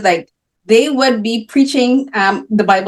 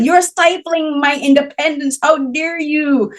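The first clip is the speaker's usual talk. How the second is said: put-on indignant teen; 6 semitones higher, slower than usual. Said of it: "we're like".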